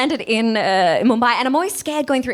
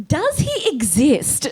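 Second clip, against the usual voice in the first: high, very slow, sceptical question; German friend; 7 semitones higher, much slower than usual. Retold or imitated. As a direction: doubt it word by word, pitched up. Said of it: "I told my German friend".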